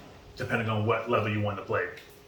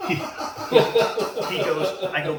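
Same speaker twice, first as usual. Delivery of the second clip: words broken up by real laughter, higher and louder; can barely get it out for laughing; 7 semitones higher, 9 dB louder, much slower than usual. Real, not acted. Laughing while retelling the doctor story to friends. Cracks up telling the Yale story.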